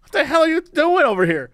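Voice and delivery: high, exasperated scolding; annoyed student rant. Pretend. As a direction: scold them like a fed-up classmate. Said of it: "I'd be like".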